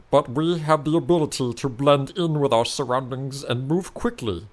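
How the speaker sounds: a lightly voiced creature telling its origin; rumbling creature voice. Changeling explains its curse to the hero, rumbling. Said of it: "answer back in a rumbling voice".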